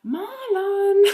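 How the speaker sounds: put-on high toddler voice, rising to a loud call; toddler in Spanish. Toddler telling the handyman there's no electricity, high call. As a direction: imitate the little girl announcing no power. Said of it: "But she says it in Spanish".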